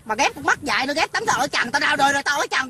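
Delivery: usual speaking voice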